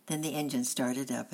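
ordinary delivery, nothing marked

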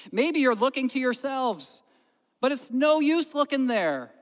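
put-on high, loud preaching in own accent; loud preacher, accent dropped. Lay preacher warning hearers off themselves, loud exhortation. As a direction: preach it out loudly without the accent. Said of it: "no, I'm not gonna do it".

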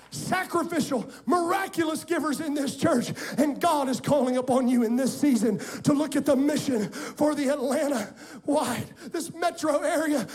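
loud, rising preacher's climax; preaching at full force. Acted. Pastor rousing the congregation to give, loud preaching. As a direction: build the church up to a shout.

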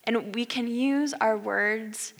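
soft, slow sermon voice; quiet, earnest preaching; hushed preaching. Acted. Closing sermon exhortation.

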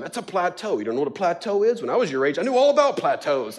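a touch of know-it-all lecturing; lightly hammed-up teacher scolding; mock condescension. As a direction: talk down like an elder. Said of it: "geography shame him".

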